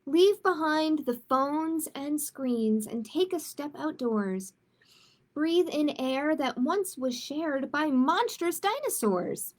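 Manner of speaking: lilting, sing-song storybook narration; picture book reading. A narrator's reading. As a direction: read the rhyme aloud to children, gently. Reads a rhyming picture book.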